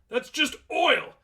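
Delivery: high, loud and slow insistence; outraged yell. Acted. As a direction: shout each word in denial.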